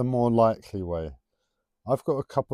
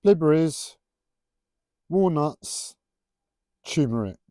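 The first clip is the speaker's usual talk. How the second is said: loud, higher put-on voice, one word at a time; punchy mock-announced list with pauses between items; different voice; 7 semitones higher, 9 dB louder than usual. Shown as a demonstration. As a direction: call out each word separately in a silly voice. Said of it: "I'll have to do it in a different voice".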